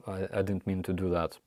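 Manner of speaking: normal talking voice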